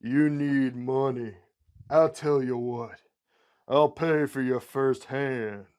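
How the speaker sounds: slow, emphatic Southern drawl; big Southern drawl, stretching every vowel; Mississippi southern drawl. Acted. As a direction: open loud and affronted, then settle into a slow Southern sales pitch. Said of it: "Mississippi southern drawl".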